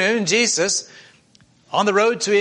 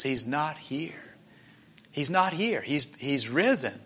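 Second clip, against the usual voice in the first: hushed angel; 3 semitones lower, 7 dB quieter than usual. Acted. Angel's tender words at tomb.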